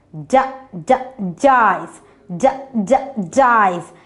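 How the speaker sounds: "D, d" as short duh bursts, then "dice"; sounding out. Shown as a demonstration. Teacher helps children hear D in dice, sounding out. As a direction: tap out the first sound twice before "dice". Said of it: "You're hearing the D sound".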